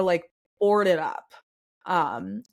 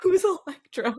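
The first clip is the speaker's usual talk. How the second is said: higher-pitched puzzled question; puzzled temple woman; 8 semitones higher than usual. Acted. Woman at the temple asked about Electra, high and puzzled. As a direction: ask it in a raised, confused voice. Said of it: "she's gonna try to see if she can figure out who you're talking about".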